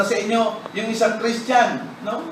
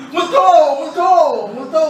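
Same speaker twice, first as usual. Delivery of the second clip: mock fright cry; 7 semitones higher, 5 dB louder than usual. Apostles crying ghost in fear.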